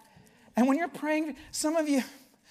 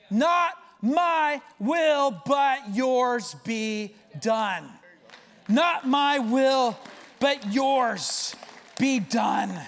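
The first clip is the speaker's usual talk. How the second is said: very slow, weighty words; slow surrender; much slower than usual. Acted. Preacher echoing Jesus's surrender, very slow and weighty. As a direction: lay each word down slowly. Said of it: "slow emphatic repetition of surrender".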